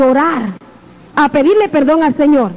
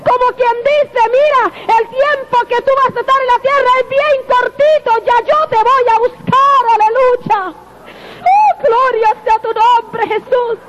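higher, calling out praise to the crowd; fervent preaching; 7 semitones higher than usual. Acted. To the congregation, the Lord's promise turned into higher fervent praise. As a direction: swell into a shouted aleluya. Quoting the Lord, then praise.

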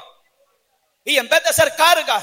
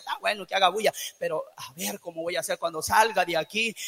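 grumbling inner thought; 8 semitones lower, 9 dB quieter than usual. Retold or imitated. Voicing distracted listener's thoughts.

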